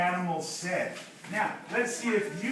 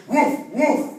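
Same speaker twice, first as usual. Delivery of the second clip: loud, forceful woofs; big dog bark; 5 semitones higher, 7 dB louder than usual. Shown as a demonstration. Teacher showing big dogs' sound, loud barking. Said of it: "if they're big dogs".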